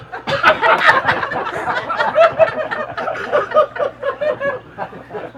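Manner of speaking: long, high-pitched laughing fit; sustained high wheeze of laughter; real laughter. Real, not acted. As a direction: burst out laughing at your own punch line. Teller laughs at his punch line.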